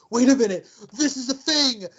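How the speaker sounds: mock excitement between twin brothers; excited realization. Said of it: "and we're like".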